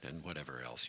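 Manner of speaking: ordinary delivery, nothing marked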